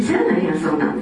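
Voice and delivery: a hint of Osaka dialect. Shown as a demonstration. Speaker showing self-talk in Osaka dialect, lightly. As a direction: say しゃあないやん the easygoing Osaka dialect way. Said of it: "大阪弁で".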